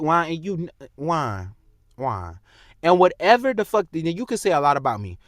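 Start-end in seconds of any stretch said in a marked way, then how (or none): 0.0-2.4 trailing off softer and lower on each name; fading taunt. Real, not acted. Vlogger taunting his rival, fading out. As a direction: let the name die away.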